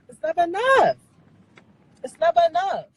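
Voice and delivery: loud, high cry of frustration; exasperated outburst. Real, not acted. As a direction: burst out at the end of your rope. Fed up despite following the rules.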